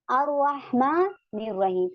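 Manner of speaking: अर्रहमानिर said slowly with the r doubled and no l; silent lam. Shown as a demonstration. Teacher shows the students the silent lam. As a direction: recite अर्रहमानिर रहीम slowly. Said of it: "अर्रहमान जब हमारा next में".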